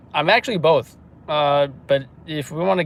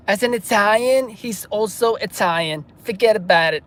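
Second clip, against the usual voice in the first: loud, higher mock Italian-American voice; Italian-American accent; 8 semitones higher, 4 dB louder than usual. Streamer reading Amanda's comment aloud, hammed-up Italian-American accent. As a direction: ham up a New York Italian accent. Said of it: "Like your accent, Amanda".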